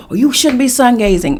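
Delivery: a lecturing ex-husband; scolding husband. Retold or imitated. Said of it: "You know what he said?".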